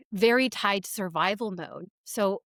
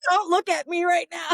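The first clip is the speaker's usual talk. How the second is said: high, loud, laughing through it; begging not to be seen while giggling; a giggly high plea; 9 semitones higher, 4 dB louder than usual. Retold or imitated. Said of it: "I'll be like".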